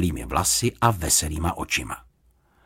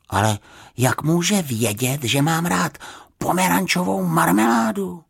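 polite bear's baffled question; wondering; 9 semitones higher than usual. A narrator's reading. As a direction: ask in mild amazement, pitched up. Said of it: "podivil se Paddington".

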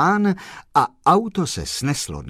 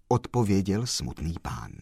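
ordinary delivery

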